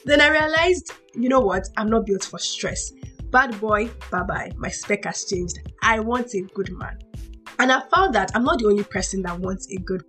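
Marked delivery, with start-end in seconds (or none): none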